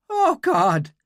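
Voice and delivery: high awed exclamation; awed Irish girl. Acted. Girl overwhelmed by meeting him, awed Irish voice. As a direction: breathe it out in wonder.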